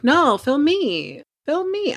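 high, slow whiny plea to be filmed; jealous demanding whine. Retold or imitated. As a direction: beg the camera like a spoiled diva. Anna jealous, demanding the camera.

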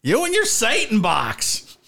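hammed-up old-time sheriff making an arrest; dastardly sheriff. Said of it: "he was arrested by the dastardly sheriff".

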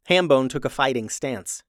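ordinary delivery, nothing marked

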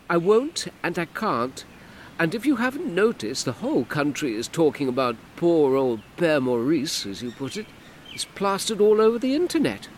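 exasperated woman; Hortense, sharp. Acted. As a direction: slam the door on his question. Hortense refuses firmly.

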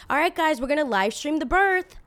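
loud, high, overexcited announcing; big fake-excited reveal; mock vlogger announcement. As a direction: parody a vlogger hyping up followers. Mocking vlogger announcing to followers.

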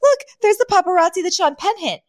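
very high, excited star-spotting voice; over-the-moon Fran. Retold or imitated. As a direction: squeal it, pointing out a sighting in delight. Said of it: "over the moon".

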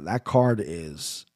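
ordinary delivery

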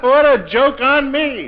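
slow, high laughing disbelief; bitter laughter. Acted. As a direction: laugh through the words bitterly. Betrayed man laughs at fate.

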